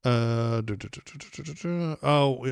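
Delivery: ordinary delivery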